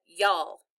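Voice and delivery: "y'all" as one Southern syllable; Southern pronunciation. Shown as a demonstration. Child showing mother's worried talk, Southern "y'all". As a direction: run it together as Southern "y'all". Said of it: "she said".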